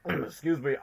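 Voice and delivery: throat full of mucus; clearing throat. Real, not acted. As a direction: cough it clear and excuse yourself. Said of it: "I am choking out of mucus".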